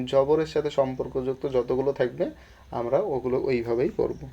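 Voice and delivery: ordinary delivery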